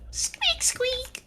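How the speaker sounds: high squeaky toy noise. Pretend.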